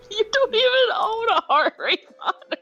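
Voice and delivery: laughing through the words. Real, not acted. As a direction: tease while cracking up. Laughing while teasing.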